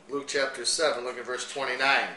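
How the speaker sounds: ordinary delivery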